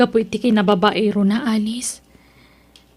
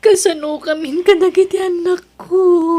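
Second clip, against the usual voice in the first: tearful plea; 5 semitones higher, 3 dB louder than usual. Acted. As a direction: voice breaking as she asks about her children. Daughter despairs over her children. (nagsangit a dawat)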